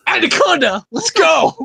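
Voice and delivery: high, rallying cheer; excited cheer. Real, not acted. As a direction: cheer your pick on like a fan. Cheering on his surprise vote.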